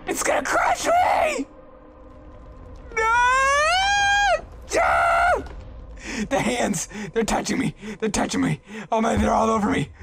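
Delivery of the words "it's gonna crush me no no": high terrified shriek; panicked scream. Pretend. Gamer voicing a trapped character, high panicked shriek. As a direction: scream as the thing comes down on you.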